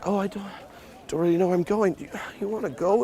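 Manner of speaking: high-pitched, dithering mock uncertainty; undecisive. Shown as a demonstration. Pickup coach showing how to repulse a girl, indecisive. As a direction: imitate someone hopelessly unable to decide. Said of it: "Be undecisive".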